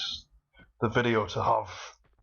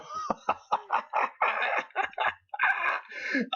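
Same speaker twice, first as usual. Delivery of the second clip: breathless wheezing laugh, high; airy laugh. Real, not acted. Cracking up at the game.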